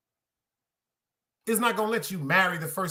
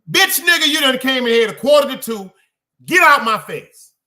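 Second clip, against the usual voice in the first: mock yelling mother, much higher and louder; furious mother; 4 semitones higher, 9 dB louder than usual. Mother yelling at her son home late, furious shout. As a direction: yell it like a mom who waited up. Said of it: "That was my mother".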